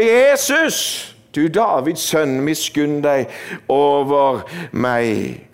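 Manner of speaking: acted desperate call, falling away slowly at the end. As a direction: shout the name, then draw out the appeal. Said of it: "roper han bare enda høyere".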